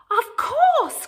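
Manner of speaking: high, bright eager reply; little girl crying out happily. Acted. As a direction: jump at the chance. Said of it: "cried Little Red".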